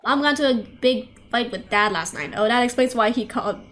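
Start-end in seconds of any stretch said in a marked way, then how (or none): none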